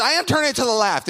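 high, loud exasperated retort; hammed-up exasperated shouting; frustrated yell. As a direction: yell back at someone giving directions. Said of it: "starting to get frustrated".

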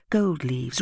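ordinary delivery, nothing marked

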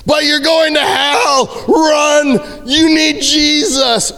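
high, crying shout, slowed and desperate; hammed-up wailing hellfire warning; frantic yelling. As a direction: scream it like a panicked street preacher. Panicked preaching at a doubter.

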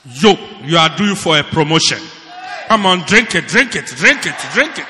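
mock prophet shouting. Pastor mocking a prophet pouring oil. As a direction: yell like a showy prophet. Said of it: "Lord, you say".

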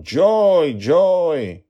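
high, loud calls while flying; searching father calling for his daughter. A narrator's reading. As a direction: call her name between the trees. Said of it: "calling out".

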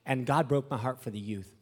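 normal talking voice

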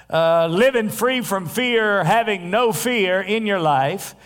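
high, ringing pulpit fervor; preaching. Acted. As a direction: preach it full out to the congregation. Preaching at full force.

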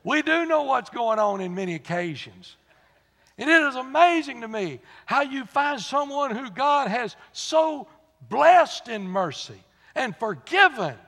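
loud, high preaching at full force. Acted. Preacher rebuking the congregation, loud and high. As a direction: drive the point home to the congregation.

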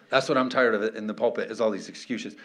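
ordinary talk, nothing marked

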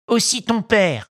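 high, gleeful sidekick echo; echoing henchman. Acted. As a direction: repeat it like a nasty parrot. Second bandit echoes.

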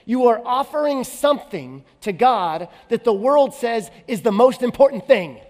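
impassioned preaching. Acted. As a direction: preach it out with full conviction. Preacher builds to full force.

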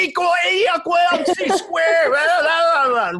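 put-on high, shouted moron-genius in a Massachusetts accent; crude Massachusetts accent. Podcaster mocking geniuses who sound like morons, crude Massachusetts accent. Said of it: "geniuses sound like fucking morons".

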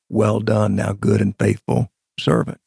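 ordinary delivery

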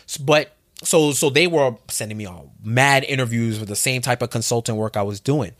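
ordinary delivery

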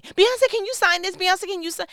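high, loud fan squeal; screaming fan. Pretend. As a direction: shriek like a fan begging for an autograph. Fans screaming at [name].

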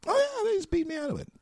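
fast, very high put-on voice; high-pitched mock aside. Voice actor repeats his complaint about losing voiceovers, high-pitched mock aside. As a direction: rattle it off in a squeaky mock voice.

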